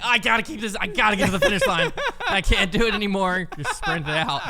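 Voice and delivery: high, loud, fast and laughing; mock desperate runner pushing to the line; laughing strained runner. Joking about a runner near the finish, high and laughing.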